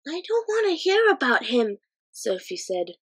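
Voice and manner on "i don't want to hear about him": put-on much higher, frightened girl; scared girl. A narrator's reading. Sophie hearing about the monster, scared girl voice. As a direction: beg him to stop, frightened.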